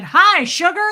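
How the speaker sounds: high, loud mock-dad hello; exuberant greeting. Dad walks in to greet the newborn, exuberant mock greeting. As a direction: sing out a big hello, imitating a delighted dad.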